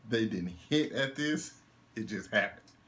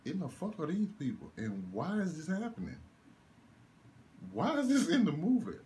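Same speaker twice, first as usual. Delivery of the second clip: high, exasperated disbelief; baffled high rant; outraged confusion; 10 semitones higher than usual. Retold or imitated. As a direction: demand to know why this is happening. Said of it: "I'm like".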